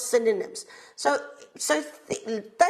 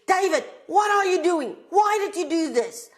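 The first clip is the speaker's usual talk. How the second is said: upset mother scolding, high and raised; angry mom; 7 semitones higher, 4 dB louder than usual. Acted. Mom scolds David for doing something wrong, angry. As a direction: tell off your child in a raised, high, angry voice. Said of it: "shows that she's angry".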